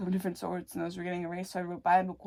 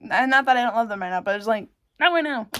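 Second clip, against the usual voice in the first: louder, fast insistence; emphatic; 2 semitones higher, 9 dB louder, faster than usual. Real, not acted. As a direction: press it hard and quick. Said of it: "but not right now".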